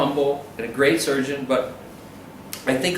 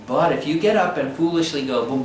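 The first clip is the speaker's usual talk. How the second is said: ordinary talk, nothing marked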